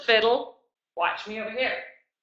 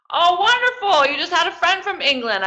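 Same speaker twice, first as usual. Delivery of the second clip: bright, delighted praise, higher and louder; excited; 9 semitones higher, 6 dB louder than usual. Real, not acted. Teacher excitedly praising a child on the call.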